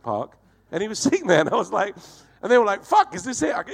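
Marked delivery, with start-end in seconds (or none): 2.8-3.5 high, amazed outburst; excited exclamation. Retold or imitated. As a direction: blurt it out in high-pitched disbelief. Crew's excited reaction on finding him.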